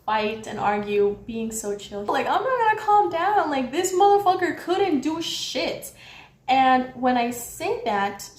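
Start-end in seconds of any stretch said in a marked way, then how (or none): 2.0-5.9 higher, indignant protest; heated refusal. Retold or imitated. Refusing the friend's plea to calm down, heated. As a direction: refuse hotly, pitch jumping up.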